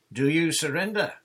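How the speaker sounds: big, loud, high challenge; shouted up. A narrator's reading. John shouting up to the pirates on the point, loud. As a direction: shout it up the cliff. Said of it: "John shouted up to the Amazons".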